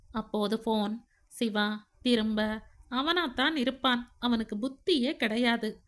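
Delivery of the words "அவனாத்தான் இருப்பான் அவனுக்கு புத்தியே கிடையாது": plain reading voice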